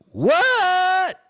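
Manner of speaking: high, loud, drawn-out "what"; incredulous high-pitched outburst; shocked yell. Retold or imitated. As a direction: shriek it in disbelief. Retold shocked reply to friend's suggestion.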